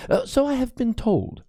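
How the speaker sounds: put-on nervous young priest apologizing to Crispin; high, timid priest, anxious and halting; fearful young priest. A narrator's reading.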